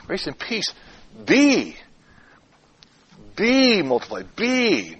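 "be" held long after a pause; long, weighty "be" standing alone; stress on BE. Shown as a demonstration. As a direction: isolate "be" and stretch it each time. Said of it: "By emphasizing different words".

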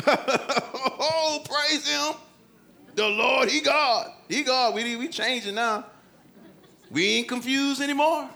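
hammed-up high praise; awestruck converts. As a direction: play a crowd hastily changing its mind. Converted people acted out, comic.

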